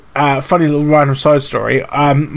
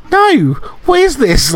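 high, slow, mock-offended protest; mock indignation; 11 semitones higher, slower than usual. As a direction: act outraged that someone wants your food. Mock outrage at partner's request.

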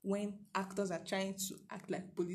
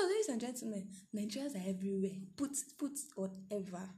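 normal talking voice

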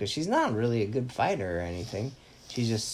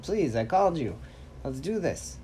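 urgent pleading; 6 semitones higher than usual. Retold or imitated. Hero pleading with distracted teammates.

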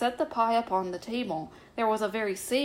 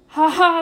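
high, very loud mock laugh; loud cawing laugh; 8 semitones higher, 13 dB louder than usual. A narrator's reading. The doctor answering Ribby at the smithy, loud cawing laugh. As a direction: burst out with a sharp storybook "ha ha".

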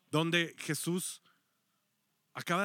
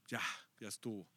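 low, drained sigh of a voice; weary Jesus; 8 semitones lower, 12 dB quieter, faster than usual. Acted. Jesus, exhausted after healing everyone, sighs quietly. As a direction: sound worn out and done with it.

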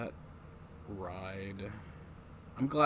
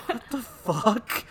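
much higher, slow, incredulous; mock disbelief; 11 semitones higher, 5 dB louder, slower than usual. Reacting to the trailer scene, high slow mock disbelief. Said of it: "I'm just like".

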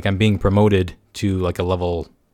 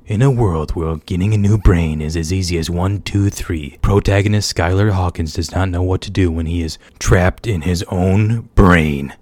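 booming trailer narrator, louder and slowed; movie trailer voice; 2 semitones lower, 5 dB louder than usual. Pretend. Spoofing a movie trailer for his brain story, booming. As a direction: boom it like a film trailer announcer. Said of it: "that was pretty freaking weird".

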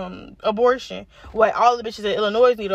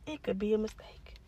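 whisper; 2 semitones lower, 13 dB quieter than usual. Real, not acted. Muttered aside under breath.

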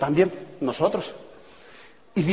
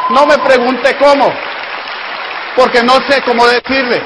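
loud, raised-pitch insistence; emphatic exclaiming; 4 semitones higher, 5 dB louder than usual. Real, not acted. Speaker telling the audience not to ask how, emphatic exclaiming. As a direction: throw it out forcefully to the crowd.

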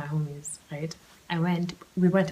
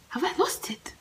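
high disbelief; 10 semitones higher, 4 dB quieter than usual. Retold or imitated. Inner conflict.

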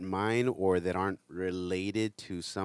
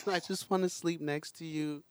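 put-on high pleading little kid voice; little girl voice; 7 semitones higher than usual. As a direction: beg like a small child.

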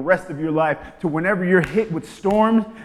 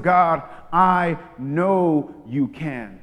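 very slow, drawn-out faith; expectant prayer; 4 dB louder, much slower than usual. Shown as a demonstration. Believer declaring faith to God, very slow. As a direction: stretch every word in belief. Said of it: "this place of".

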